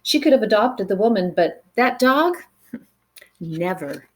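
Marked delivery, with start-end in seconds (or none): none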